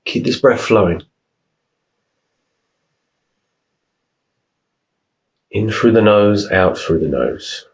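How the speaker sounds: unhurried meditation voice, long pauses between phrases; guided meditation. Real, not acted. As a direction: calmly guide them through each breath, leaving room to breathe. Leads a breathing meditation.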